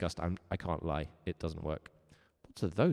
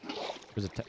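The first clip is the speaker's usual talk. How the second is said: whispered; 8 dB quieter than usual. Real, not acted. Whispered aside.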